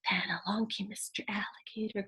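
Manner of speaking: sneaky whisper; hushed alligator creeping. Acted. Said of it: "quiet as could be".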